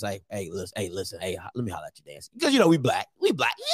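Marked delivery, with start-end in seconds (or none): 0.0-2.3 low, soft-spoken recruiter; mock SWAC recruiter, low and hushed; recruiter pitch. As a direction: sidle up and holler at the coach. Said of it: "it'd be like this".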